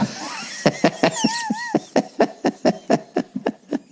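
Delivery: high-pitched wheezy cackle; breathy falsetto laugh; high wheezing laugh. Real, not acted. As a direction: let out a squeaky laugh. Laughs at own joke.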